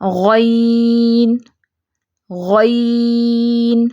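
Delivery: غین held very long and slow; drawn-out ghain. Shown as a demonstration. Teacher demonstrating غین to students, very drawn out. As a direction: stretch غین out slowly. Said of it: "اس کی ادائیگی ذرا لمبا کر کے ہوتی ہے".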